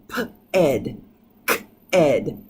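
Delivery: "p-ed" and "k-ed" with a separate "ed" syllable; mock wrong ending; wrong pronunciation. Shown as a demonstration. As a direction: sound out the wrong extra syllable. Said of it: "It's not gonna work and it doesn't sound right".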